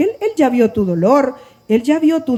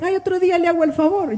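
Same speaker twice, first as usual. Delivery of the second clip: quick, higher mock excuse; imitated brush-off; 5 semitones higher, 4 dB quieter than usual. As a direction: mimic a breezy promise for some other day. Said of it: "cuando me dicen".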